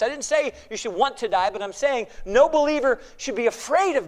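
forceful preaching, key words punched; emphatic preaching. Real, not acted. Preacher urging believers to be bold in Christ, emphatic preaching. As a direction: preach it boldly with big rises and falls.

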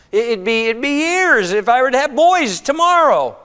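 high, insistent acted argument; impassioned protest. Naomi tells her daughters-in-law waiting is pointless, in impassioned protest. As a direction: argue it hopelessly, pitched high.

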